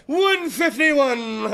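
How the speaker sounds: long, drawn-out moan blaming the rum; mock drunken wail. Host imitating his friend puking into a trash can, mock wailing. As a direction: wail it very slowly, hammed up.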